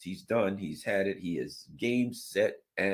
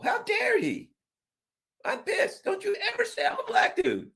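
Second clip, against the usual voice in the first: mock outrage. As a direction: fake being scandalised, half laughing. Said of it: "And I say".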